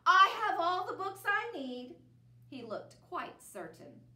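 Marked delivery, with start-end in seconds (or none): 0.0-2.0 loud, high, curt refusal; cutting him off. Acted. Bear cuts off Mouse, loud and certain. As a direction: cut him off firmly. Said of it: "But Bear cut him off".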